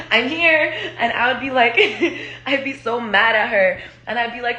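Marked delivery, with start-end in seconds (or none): 0.1-0.7 high singled-out mock-cheery announcement; chirpy mom imitation. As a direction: announce yourself as the ever-present mother. Imitates mom turning up after breakups.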